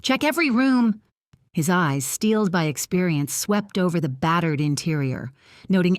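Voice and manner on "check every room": higher, barked commands; barked orders. Acted. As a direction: bark it out with authority. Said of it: "calm, authoritative tone ... as he barked".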